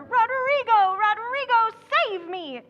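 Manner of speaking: hammed-up horrified stage cry; high, loud plea for rescue, demonstrated; cry of horror. Shown as a demonstration. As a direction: play the terrified damsel, overdone. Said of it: "you cry out in horror".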